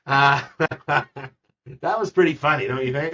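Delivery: laugh, then talk on through it; amused laughing. Real, not acted. Host tickled by own spelling joke to agent, laughing and loud.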